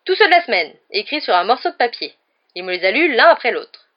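loud, high-starting proud declaration. A narrator's reading. Knight explains the passwords to the professor, proudly. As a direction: boast of it like a knight. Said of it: "assura fièrement le chevalier".

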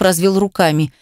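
plain reading voice

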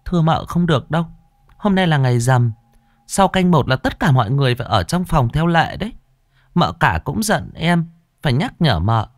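high-pitched servant's voice, urging; pleading maid. A narrator's reading. Maid stopping her mistress on the full-moon night, high and urging.

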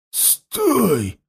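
hoarse, menacing challenge. A narrator's reading. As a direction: bark it out hoarsely. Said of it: "раздался сиплый голос". (сиплый угрожающий окрик)

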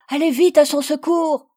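high, loud urgent call; a woman shouting to send help. Acted. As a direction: hurry them to the rescue.

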